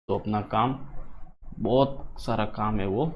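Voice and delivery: ordinary talk, nothing marked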